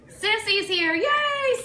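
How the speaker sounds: a bright, high, delighted yay; excited cheer. Real, not acted. Host cheering as viewer Sissy joins the live show, excited.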